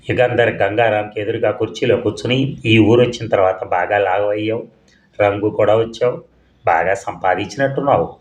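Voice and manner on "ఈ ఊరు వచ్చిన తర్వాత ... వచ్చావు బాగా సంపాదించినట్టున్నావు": ordinary delivery, nothing marked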